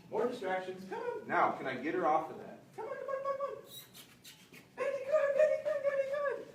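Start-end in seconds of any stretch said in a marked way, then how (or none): 0.9-1.3 high-pitched puppy call; high coaxing. Real, not acted. Trainer calling a puppy in a high coax. 2.8-3.6 high-pitched sing-song puppy call; high coaxing. Real, not acted. Trainer luring the puppy with a high call. 4.8-6.5 high, happy puppy praise. Real, not acted. Trainer praising the puppy in a high happy voice.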